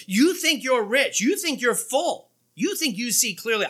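high, stretched-out taunt; mocking challenge. Preacher voices Jesus challenging the Laodiceans, sarcastic. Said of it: "If he talks like that".

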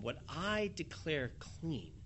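a quiet grave decree; solemn declaration. Acted. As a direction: lay it down slowly and softly. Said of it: "the Lord makes this declaration".

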